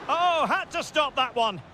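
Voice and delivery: high exclamation at a near miss; excited reaction. Real, not acted. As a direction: react sharply to a close chance.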